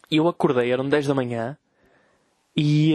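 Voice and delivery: ordinary delivery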